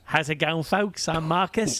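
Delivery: put-on high-pitched Aussie accent. Podcast host opens the intro in an Aussie accent. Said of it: "I'll do an Aussie accent".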